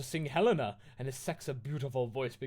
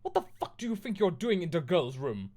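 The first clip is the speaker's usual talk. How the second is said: higher, louder indignant yell; hammed-up outrage at the intruders; angry shout; 6 semitones higher, 6 dB louder than usual. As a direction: goth girl yelling at Hagrid. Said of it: "I shouted angrily".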